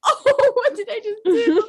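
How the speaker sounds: high, slow, teary mock panic; retold crying. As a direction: replay the kid who just got caught, half crying. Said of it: "I started crying".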